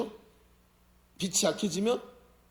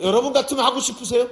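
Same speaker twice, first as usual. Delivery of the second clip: ordinary talk, nothing marked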